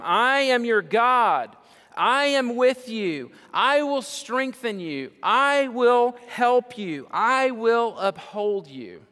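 slow, high and loud, each promise ringing out; booming proclamation. Acted. Preacher voicing God to his congregation, booming. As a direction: proclaim God's words over the church, slowly.

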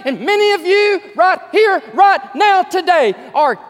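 loud, high fervent sermon; full-voiced revival preaching; preaching at full force. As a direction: proclaim it to the rafters.

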